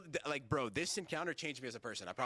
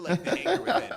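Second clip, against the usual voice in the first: laughing; 12 semitones higher, 11 dB louder than usual. Real, not acted. Laughing about his own anger.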